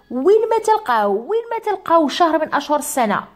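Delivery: stressing a key grammar rule; emphatic rule. Shown as a demonstration. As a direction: insist on "وين ما تلقاو". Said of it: "معلومة مهمة جدًا".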